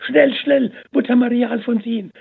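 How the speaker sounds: very high, loud breathless call; urgent excited call. A narrator's reading. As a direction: burst in calling with joyful urgency. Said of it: "rief die Schwester Katharina".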